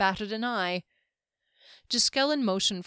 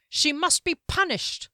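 high, raised-voice condemnation; clear cry; 8 semitones higher, 4 dB louder than usual. A narrator's reading. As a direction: proclaim it high to the whole crowd. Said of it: "he cried in a clear voice".

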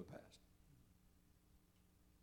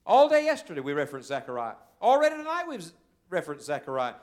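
louder, pressing point; emphatic preaching; 4 semitones higher, 10 dB louder than usual. Acted. Preacher stressing Zechariah to the congregation, loud. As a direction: lean into it for the crowd.

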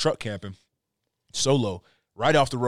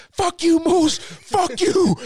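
much higher, louder retold yell; yelling at moose; 5 dB louder, much slower than usual. Hunter yelling the moose away from his tent, high loud yell. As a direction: scream it at the animal to scare it off.

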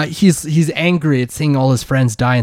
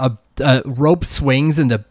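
ordinary talk

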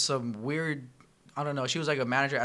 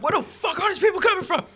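mock frantic yell; 7 dB louder than usual. Retelling frantic rush at work.

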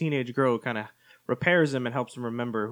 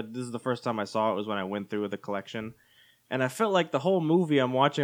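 ordinary talk

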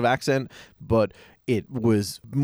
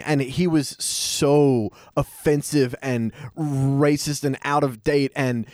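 normal talking voice

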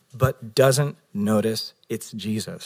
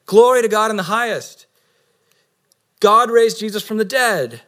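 higher and louder, a heralding angel; grand angel proclamation; 7 semitones higher, 5 dB louder than usual. Pretend.

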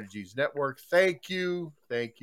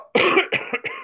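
sudden harsh coughing fit; choking cough; 10 dB louder than usual. Real, not acted. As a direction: cough hard, choking on something. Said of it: "I'm choking".

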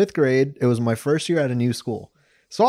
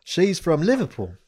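put-on British accent; 6 semitones higher than usual. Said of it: "Talked to him in a British accent the whole way".

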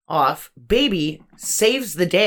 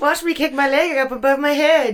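high, goofy put-on voice; mock karate braggart; 8 semitones higher than usual. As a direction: show off in a silly high voice. Joke role-play as a pretended character.

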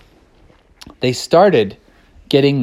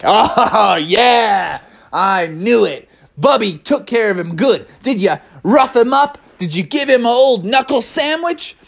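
gleeful gloating; 6 semitones higher than usual. Acted. Friends gloating, laughing.

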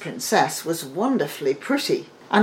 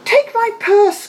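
put-on young man; 8 dB louder than usual. A narrator's reading. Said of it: "said he".

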